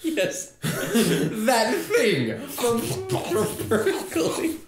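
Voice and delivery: slow, high words through laughter; laughing. Real, not acted. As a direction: laugh it out while answering. Laughing at Fern Gully reference.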